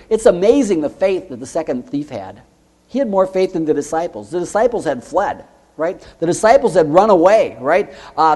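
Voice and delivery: ordinary talk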